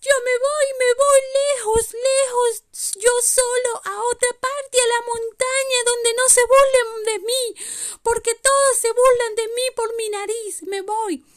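voz aguda y fuerte de renito; pequeño reno dolido. Acted. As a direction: queja a gritos con vocecita muy aguda. (reno pequeño, agudo y dolido)

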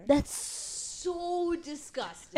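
slow, high, drawn-out disgust. Real, not acted. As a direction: stretch it out, grossed out. Reacting to booger story.

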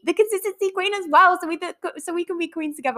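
high, rushed and tripping over words; getting carried away, pitch jumps up; real excitement. Real, not acted. Podcaster promising listeners they can be queens together, excited. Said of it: "I'm getting well excited".

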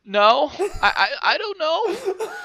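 high, loud, stammering fake sob with laughter; mock crying. Student answers the yelling dean, mock crying. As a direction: whimper it out slowly, hammed-up. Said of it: "I'm starting to cry".

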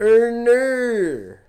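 high, loud mock-Australian "no"; exaggerated Australian vowels; Australian accent. Shown as a demonstration. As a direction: bend "no" into a big Australian wail. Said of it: "Australian for".

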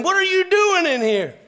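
high, loud imitation of wife; shrill-pitched, shouted demand; alarmed scolding shout. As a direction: yell it like an alarmed mother bursting in. Wife runs in on screaming child.

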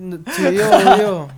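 laughing through the words; words breaking into laughter; giggling reply. Real, not acted. Laughing after joke.

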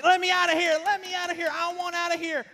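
put-on high, full-volume child's screams; imitated frantic yelling. Locked-in son yelling through door, mock-frantic. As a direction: scream to be let out, hammed-up.

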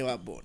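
"[name]" said low and soft with a falling end; dropped voice. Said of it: "dropped low for the name".

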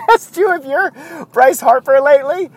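high, giggling question; laughing tease. Real, not acted. Teasing Mario about looking like Bryce Harper, through laughter.

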